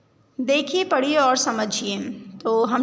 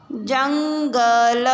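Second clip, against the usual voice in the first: Jungle drawn out very slowly and a little louder; slow syllable reading; 4 dB louder, much slower than usual. Shown as a demonstration. Teacher sounds out jungle for the class, slowly and firmly. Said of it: "शब्दों को पढ़ेंगे".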